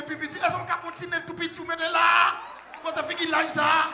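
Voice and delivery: loud, high shouted preaching; preaching at full force. Real, not acted. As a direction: drive the message home to the church. (prêche à pleine voix, criée)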